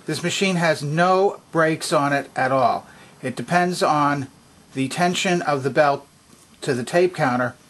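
ordinary talk, nothing marked

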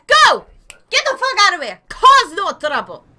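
high, loud marching orders; mock bossy shout. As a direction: yell them on through the gate. Ordering traveller through the gate.

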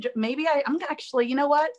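usual speaking voice

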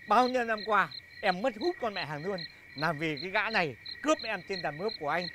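normal talking voice

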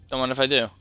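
ordinary delivery

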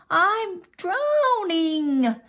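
high, drawn-out cry of alarm. A narrator's reading. Froggy splashed into the pond, high drawn-out cry. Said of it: "cried Froggy".

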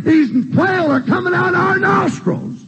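high, drawn-out griping; mock complaint. Israelites griping about too much quail, high drawn-out mock complaint. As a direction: whine it slowly, way up high, imitating complainers.

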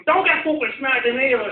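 high loud thuggish snap; mock knacker warning. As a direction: warn him off like a street tough.

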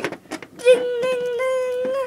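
high sung ding ding ding; high bell ding. Pretend. Bell after the pin.

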